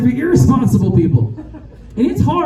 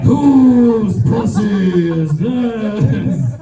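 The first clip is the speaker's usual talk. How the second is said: angry shout; much slower than usual. Retold or imitated. Retelling a lover's angry demand, yelled slowly. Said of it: "He just got more angry".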